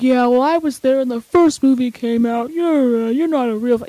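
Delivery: lightly mocking fan gatekeeper; sneering a little, as a put-on skeptic; mock doubters. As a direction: imitate a dismissive know-it-all fan. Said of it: "a lot of people are like".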